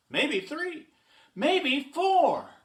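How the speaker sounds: high, rising story-character excitement; mounting excitement. Acted. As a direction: build the count up eagerly. Horton argues excitedly in story.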